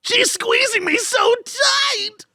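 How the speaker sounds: very high whiny anime sidekick. Acted. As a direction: squeak it out like a panicky cartoon gremlin. Sidekick squeezed.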